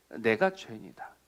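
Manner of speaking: low, soft, subdued admission; quiet confession. Acted. Preacher voicing the sinner's confession people resist, quiet confession.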